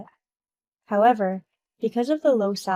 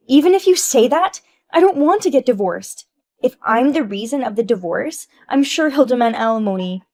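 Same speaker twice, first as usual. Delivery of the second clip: panicked plea; 3 semitones higher, 8 dB louder than usual. Acted. Wife panicking to her friend about divorce. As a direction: beg against divorce.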